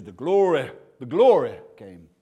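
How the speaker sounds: mock church cry, swooping up loud on "glory"; imitating churchy delivery. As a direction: punch and swell "glory" like a revivalist. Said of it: "why they say it like that".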